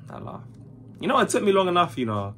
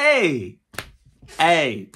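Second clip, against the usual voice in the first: shouting; 4 semitones higher, 5 dB louder than usual. Real, not acted. Protesting the joke.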